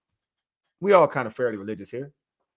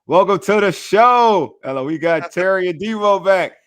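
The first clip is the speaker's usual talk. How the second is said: big hyped announcer shout; 6 semitones higher, 7 dB louder than usual. Acted. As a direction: belt it out like a show opener. Hyped catchphrase, escalated repeat.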